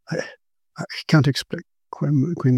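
halting, repeated starts; stammering. A narrator's reading. Shaken narrator trying to explain to Quinn, stammering. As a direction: stumble over the words, shaken. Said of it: "I stammered".

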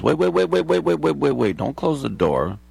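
frantic, rapid, high-pitched pleas; pleading Jehovah's Witness. Retold or imitated. Old Jehovah's Witness begging a college kid at the door, frantic high pleading. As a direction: beg him not to shut the door, fast and high. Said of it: "He goes".

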